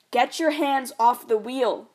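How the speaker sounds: loud, commanding father; a father seizing the wheel, loud and harsh; demanding dad. Acted. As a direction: bark the order at her. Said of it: "my dad demands".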